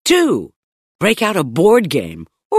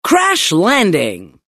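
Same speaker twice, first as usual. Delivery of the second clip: usual speaking voice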